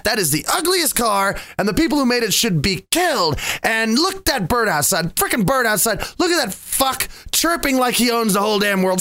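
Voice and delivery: hammed-up annoyed tirade; annoyed ranting. Shown as a demonstration. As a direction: rant breathlessly about every petty annoyance. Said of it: "That's what I sound like when I'm happy".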